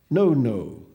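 loud firm correction; correcting. Acted. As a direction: set him straight. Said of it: "corrected him".

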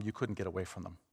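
ordinary talk, nothing marked

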